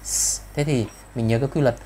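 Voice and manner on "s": "S" as a bare hiss; a sustained sss for "S"; voiceless S. Shown as a demonstration. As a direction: hiss "S" alone without any voice. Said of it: "chữ S là vô thanh".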